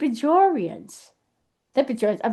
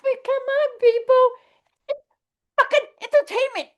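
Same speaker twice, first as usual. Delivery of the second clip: pitched high and fast, fed up; exasperated outburst; 11 semitones higher, faster than usual. Real, not acted. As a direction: throw up your hands at them. Exasperated outburst at complaining fans.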